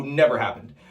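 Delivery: ordinary delivery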